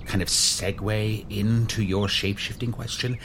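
ordinary talk, nothing marked

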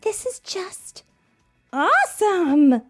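breathed awe. Acted. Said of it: "she breathed".

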